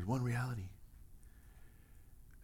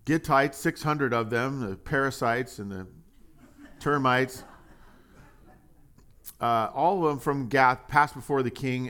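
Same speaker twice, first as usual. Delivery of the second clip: steady, slightly louder reading voice; scripture reading; 3 semitones higher, 4 dB louder than usual. Acted. Preacher to congregation, reading verse seventeen aloud. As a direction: lay out the list of peoples for the listeners.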